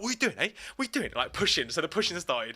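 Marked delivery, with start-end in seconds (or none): 0.0-1.1 mock aggressive challenge. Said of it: "was like".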